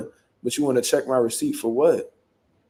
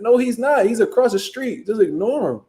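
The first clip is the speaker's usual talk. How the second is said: higher-pitched retold contradiction; mock argumentative; 7 semitones higher than usual. Driver contradicts the officer, mock arguing. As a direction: cut her objection down, pitch up.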